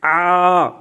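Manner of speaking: آ held for one alif; prolonged aa as in recitation; long drawn aa. Shown as a demonstration. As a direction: stretch the آ out evenly. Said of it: "تو آپ پڑھیں گے".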